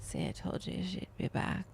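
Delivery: breathy hushed whisper, not to wake her. Real, not acted. Whispering to viewers about the dog back in bed. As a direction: whisper it so the dog stays asleep. Quiet moment watching the sleeping dog.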